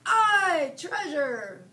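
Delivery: high loud crew shout; pirate crew shouting about treasure; shouted pirate cheer. A narrator's reading. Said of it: "the other shouted".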